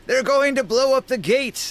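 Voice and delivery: high-pitched alarmed warning; crying out. Acted. Scion warning of explosives at the gate, alarmed cry. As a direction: raise the alarm at the top of your range. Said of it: "And he cries out".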